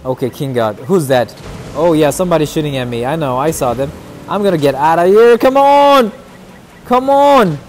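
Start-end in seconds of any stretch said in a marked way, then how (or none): 4.3-7.6 loud, high "come on!" twice; exasperated loud high cries; frustrated shout. Real, not acted. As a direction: yell at the game in frustration. Fleeing under fire.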